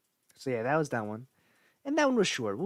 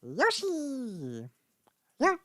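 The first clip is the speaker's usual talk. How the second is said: much higher, squeaky Yoshi call; 6 dB louder, much slower than usual. Retold or imitated. Showing off the Yoshi impression to the viewers. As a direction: chirp it like the little dinosaur. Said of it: "my Yoshi's pretty okay".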